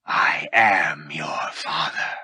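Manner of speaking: low, very slow dark lord voice; calm revelation. Acted. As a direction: let the truth drop heavily. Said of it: "Vader replied calmly".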